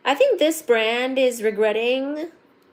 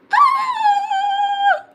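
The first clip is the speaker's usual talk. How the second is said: very high excited gasp; 5 dB louder than usual. Real, not acted. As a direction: gasp in delight. Sees the gift open.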